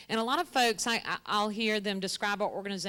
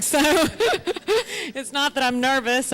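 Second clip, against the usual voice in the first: laughing; 6 semitones higher, 10 dB louder, much slower than usual. Real, not acted. Nervous laugh while freezing.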